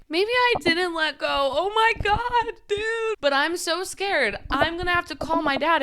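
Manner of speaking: fighting back real tears; on the verge of tears. Real, not acted. Telling viewers about her dad, voice breaking into tears. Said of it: "I'm gonna cry right".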